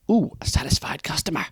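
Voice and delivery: whispered aside. Acted. Trainer's aside about happy customer.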